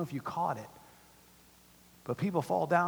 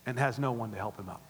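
usual speaking voice

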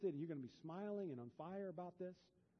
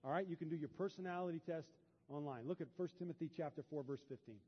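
ordinary talk

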